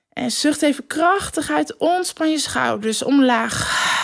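guided breathing. Acted. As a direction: guide the listener to relax. Leads a breathing exercise.

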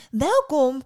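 high, loud greeting; called-out welcome. A narrator's reading. Old woman running out to a visitor, calling. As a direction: cry it out as an eager old hostess. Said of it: "riep de oude vrouw".

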